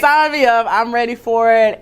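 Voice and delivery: high-pitched, playful retold eagerness; eager volunteering. Student retelling how she volunteered for Team USA, eager.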